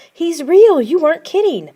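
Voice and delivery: high, loud shocked cry. A narrator's reading. Sister seeing the real snowman, high and loud in shock. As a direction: blurt it out in shock.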